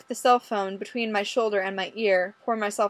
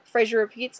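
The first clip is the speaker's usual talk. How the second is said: plain reading voice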